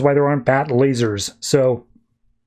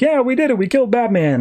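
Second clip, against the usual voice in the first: mock triumphant cheer; 9 semitones higher than usual. Robbers think they killed Batman, mock triumph. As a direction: crow about the win in a raised pitch. Robbers celebrating their supposed victory.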